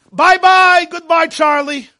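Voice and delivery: loud, high mock send-off; loud dismissal. Speaker mocks rejecting someone who fails the checklist, loud dismissal. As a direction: call out a breezy, emphatic goodbye.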